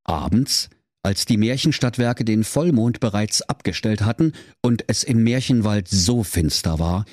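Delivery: ordinary talk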